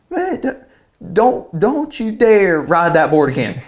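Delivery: high, slow, halting mock plea; upset dad imitation. Dad to son about his board, mock emotional warning. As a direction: imitate a choked-up dad laying down the law.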